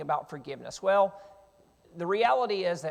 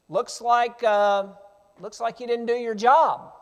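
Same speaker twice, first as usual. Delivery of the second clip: raised, loud taunting call; yelling out; 4 semitones higher, 7 dB louder than usual. Acted. David taunting the general who failed to guard, yelling out. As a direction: needle him from a distance, loudly. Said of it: "he's yelling out to Abner".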